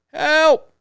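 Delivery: high, loud mock cry of help; yelling for help. Retelling a horror clip, mimicking a man yelling for help. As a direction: call out for help like a man lost in a field.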